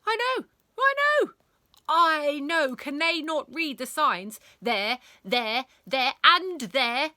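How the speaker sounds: loud, high, indignant teacher; worked-up outburst from an outraged teacher; exasperated ranting. A narrator's reading. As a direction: rant in outrage, hammering each word. Exasperated teacher rants about ignored signs.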